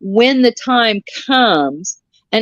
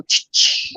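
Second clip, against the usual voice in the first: sharp voiceless "ch" zaps; imitated lightning strike. Telling friends how lightning will strike, imitated zap noise. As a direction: make lightning zaps with hissed ch sounds. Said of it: "come down and just go".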